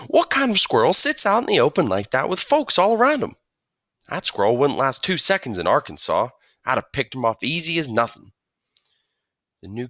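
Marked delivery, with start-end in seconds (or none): 0.1-8.3 put-on higher, louder country kid voice; new kid praising the sandwich, chattering on; country boy. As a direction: chatter like a friendly farm boy. Rufus bragging about hunting.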